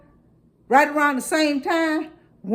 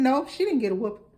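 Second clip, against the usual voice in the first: ordinary talk, nothing marked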